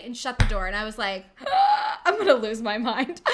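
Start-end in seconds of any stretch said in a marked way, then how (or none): 1.3-2.0 high, loud shocked gasp; startled loud gasp; sharp gasp. Retold or imitated. Outraged reaction. 2.1-3.2 loud, laughing frustration; half-laughing, at wit's end; laughing exasperation. Retold or imitated. Exasperated retelling.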